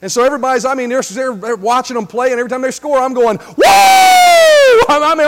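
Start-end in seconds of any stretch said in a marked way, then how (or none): 3.4-3.6 full committed shout. Shown as a demonstration. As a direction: whoop at full force for a touchdown. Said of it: "I'm shouting".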